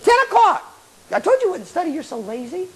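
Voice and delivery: high-pitched nagging little voice; scolding inner voice. Acted. Little voice scolding a lazy student at ten o'clock, nagging. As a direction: scold from a pitch well above your own, easing off at the end. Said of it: "little boy says".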